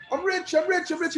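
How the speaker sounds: dazed with sudden wealth; giddy excitement. Acted. Man finds diamonds everywhere.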